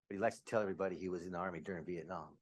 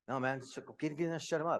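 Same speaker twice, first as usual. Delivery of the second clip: mildly angry retold command, pitch raised; mock demanding tone, somewhat louder; retold impatient order; 6 semitones higher, 4 dB louder than usual. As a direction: act out an impatient father ordering his partner. Retold demanding drunk father.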